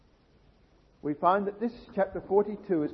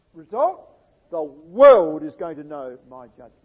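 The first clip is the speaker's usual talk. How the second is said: preaching at full force; 3 semitones higher, 14 dB louder than usual. Acted. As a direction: drive the promise home. Preacher proclaims God's promise.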